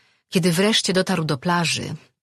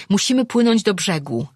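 high, urgent call; 5 semitones higher, 4 dB louder than usual. A narrator's reading. Frightened crewman urging flight to shore, calling out. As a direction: call out to the whole crew. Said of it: "zawołał któryś".

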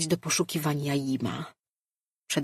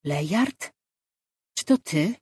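faint distant reply. Acted. Distant dream contact voice.

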